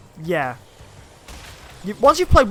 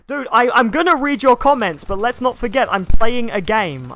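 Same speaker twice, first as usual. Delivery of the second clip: louder, half-joking exasperation; exasperated; 5 semitones higher, 7 dB louder than usual. Real, not acted. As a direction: call the viewer out. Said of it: "addressing impatient commenter".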